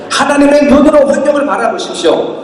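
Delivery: ordinary delivery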